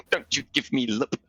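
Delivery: high-pitched mock scolding; telling off. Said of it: "tries to tell off".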